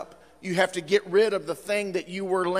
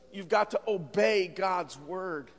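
usual speaking voice

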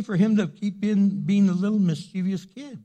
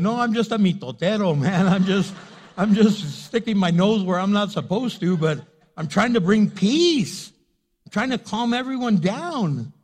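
louder, higher hammed-up peacemaker speech; exaggerated retelling; 3 semitones higher, 7 dB louder than usual. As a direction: ham it up as the self-appointed peacemaker. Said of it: "I didn't say all that".

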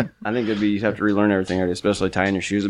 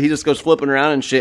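ordinary delivery

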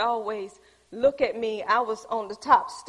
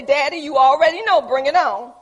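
bold declaration; 9 dB louder than usual. Pretend. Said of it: "look up and say".

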